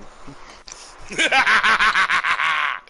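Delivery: high, loud villain cackle; unhinged evil laugh. Acted. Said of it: "I'm gonna try and do my, uh, evil laugh".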